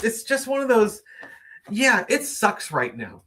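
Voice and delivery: normal talking voice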